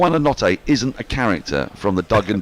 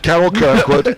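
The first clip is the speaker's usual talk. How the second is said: mock weather presenter voice; 7 semitones higher, 6 dB louder, slower than usual. Echoing name in mock voice.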